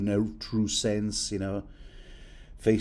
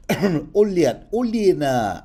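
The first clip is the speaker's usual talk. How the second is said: put-on "Olenna" said louder and higher in a jokey Russian accent; hammed-up Russian accent on "Olenna"; mock Russian accent; 8 semitones higher, 7 dB louder, slower than usual. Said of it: "in the proper Tyrell Russian accent".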